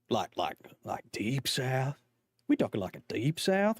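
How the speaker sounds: thick Deep South drawl; trying out a Deep South accent. Shown as a demonstration. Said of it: "give your best Southern accent".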